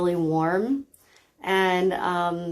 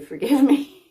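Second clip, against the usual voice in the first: higher, louder, through a laugh; laughing apology; 7 semitones higher, 5 dB louder than usual. Real, not acted. Guide joking to listeners about losing track, laughing. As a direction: laugh it off as you ask forgiveness. Jokes about spacing out mid-meditation.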